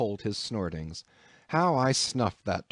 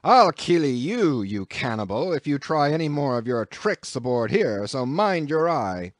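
loud, higher menacing roar; angry threat; 3 semitones higher, 5 dB louder than usual. A narrator's reading. Captain warning Queequeg off his tricks, angry roar. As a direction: threaten him hard. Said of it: "roared the captain".

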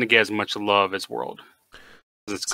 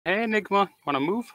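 high, soft coaxing voice; gentle coaxing; 11 semitones higher, 4 dB quieter, faster than usual. Real, not acted. Speaker asking Enigma to move, gently coaxing. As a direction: coax sweetly, lifting at the end.

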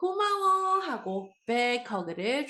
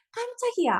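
much higher startled exclamation; a sudden jump of fright; startled cry; 12 semitones higher, faster than usual. Shown as a demonstration. Teacher acts surprise before asking Dad's line, high startled cry. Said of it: "아빠 어떻게 말한다고요?".

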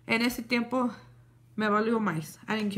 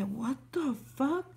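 slow, hushed disbelief; stunned; 3 semitones higher, 6 dB quieter, slower than usual. Real, not acted. Finding the boyfriend's car dented in her driveway, slow hushed shock. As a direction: drag it out in quiet shock.

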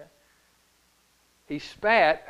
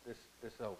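hushed and final; 4 semitones lower, 9 dB quieter, faster than usual. Retold or imitated. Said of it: "And Jesus said".

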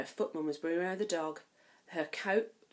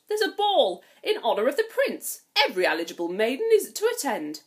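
high, loud grand announcement; announcing stepmother; 5 semitones higher, 8 dB louder than usual. Acted. Stepmother announces the ball to the girls, loud and grand. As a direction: proclaim it to the household. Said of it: "stepmother announced".

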